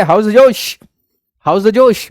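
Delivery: loud, high rally cry to wake the class; rousing pep call. Acted. Teacher wakes sleepy students with a loud, high rally cry. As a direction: shout it out like a cheer to fire them up.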